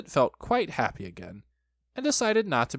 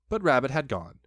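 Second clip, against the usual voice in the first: usual speaking voice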